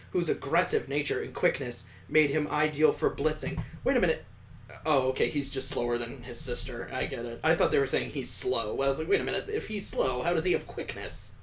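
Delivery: ordinary delivery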